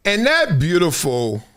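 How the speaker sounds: usual speaking voice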